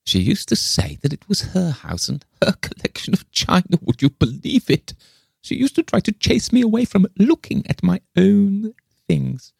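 put-on high, aggrieved old woman's voice; querulous eccentric old lady. A narrator's reading. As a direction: complain in a high, peculiar old voice. Le Fane complains of the other woman.

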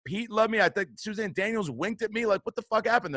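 high-pitched disbelieving rant; indignant protest. Retold or imitated. Argues with agent after losing part.

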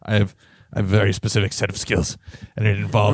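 low, fast Liam Neeson voice; Liam Neeson impression. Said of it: "That's Liam Neeson".

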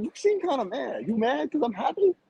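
high-pitched mock-innocent taunt; retold incredulous jab, high; retold needling. Retold taunting of angry fan.

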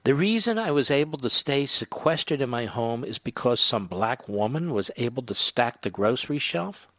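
quietly quoting him in disbelief; incredulous reread quote. Retold or imitated. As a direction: repeat the quote, disbelieving and flat. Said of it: "Who talks like this?".

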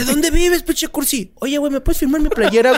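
high, mocking thug voice; hammed-up threatening fan; frat bro. Acting Rudo y Cursi scene.

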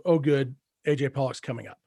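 ordinary delivery

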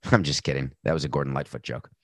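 low fast aside; low rapid sheepish aside. Real, not acted. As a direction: toss it off as a quick low aside. Admits the joke aside.

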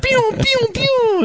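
high squeaky laser-gun noises, laughing; giggly mock laser-gun sound effect; pew pew gun sounds. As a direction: squeal pew pew like a toy blaster. Mimicking gunfire sound effect.

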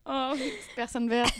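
ordinary talk, nothing marked

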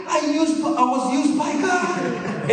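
high, drawn-out mock scream of joy; high-pitched elated cry, slowing down; retold excited screaming. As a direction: re-enact overjoyed screaming, without full volume. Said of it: "like, screaming, shaking".

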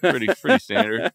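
chuckling through the words; laughing while talking. Real, not acted. Laughing aside.

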